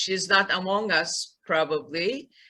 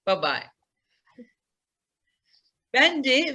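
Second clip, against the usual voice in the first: ordinary delivery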